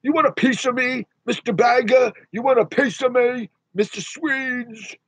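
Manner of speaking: high, far puppet voice challenging viewers; squeaky-style puppet picking a fight; puppet taunt. Acted. Puppet voice taunting chat viewers.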